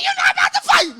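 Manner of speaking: high, loud shout forbidding the fight; mom's yell. Retold or imitated. Mom yelling at her sons not to fight. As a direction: scream it down at them. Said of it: "she like".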